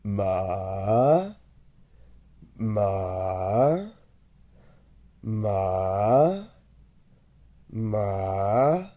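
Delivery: "ma" dipping low, then rising; low dipping Mandarin tone on "ma"; third tone. Shown as a demonstration. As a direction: draw each "ma" down low before lifting it. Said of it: "Let's take each one at a time".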